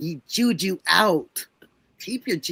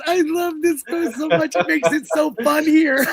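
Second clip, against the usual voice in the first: loud, higher, laughing through words; laughing delight; 6 semitones higher, 7 dB louder than usual. Real, not acted. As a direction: gush and laugh loudly.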